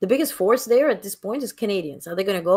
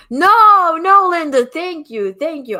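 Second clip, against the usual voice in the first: much higher and louder "no, no", then settling into thanks; eager protest; 8 semitones higher, 9 dB louder, slower than usual. Real, not acted. Host cutting off Linda's apology about the orange, eager. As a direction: wave off her apology with a burst of gratitude.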